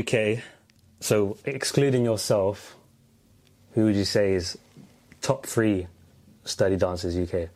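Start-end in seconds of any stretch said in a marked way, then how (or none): none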